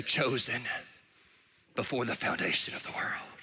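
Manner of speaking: hushed, unvoiced reading of the sign; awed whisper. Reading the sign over heaven's gates, awed whisper. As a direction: let it fall to a reverent whisper.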